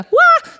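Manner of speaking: high loud shriek of fright; startled yelp. Retold or imitated. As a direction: yelp as if seeing a snake. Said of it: "Han ska inte säga så här". (högt skräckskrik)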